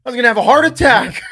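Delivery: high, loud, building to a mock shout; hammed-up panicked wired dad; jacked up. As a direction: build to a hysterical comic yell. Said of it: "like, a little jacked up".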